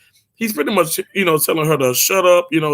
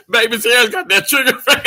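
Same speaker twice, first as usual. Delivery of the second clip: laughing; 5 semitones higher, faster than usual. Real, not acted. Laughing at a chat joke.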